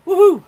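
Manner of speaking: very high, loud woohoo; whooping cry. Real, not acted. Reacting to the lemonade powder, a loud high whoop. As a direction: let out a wild whoop.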